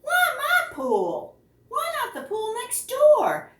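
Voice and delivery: put-on a kid outraged at the whale; indignant boy. Boy protesting to the whale in his pool, high indignant wail.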